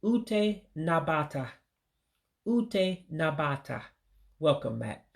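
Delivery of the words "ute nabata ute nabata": ute nabata, slow and careful in Igbo; teacher modelling ute nabata; Igbo word. Shown as a demonstration. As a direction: say ute nabata slowly, each syllable clear. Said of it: "it will be pronounced".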